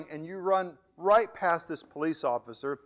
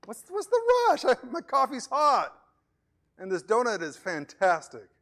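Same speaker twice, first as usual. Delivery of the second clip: a hammed-up lazy officer enjoying his donut; lazy cop; 10 semitones higher, 5 dB louder than usual. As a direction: brush off the victim with mock nonchalance. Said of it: "You're like".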